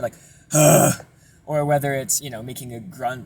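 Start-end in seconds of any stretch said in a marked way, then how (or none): 0.5-1.0 forceful rough sigh; hard sigh. Shown as a demonstration. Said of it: "sighing really hard".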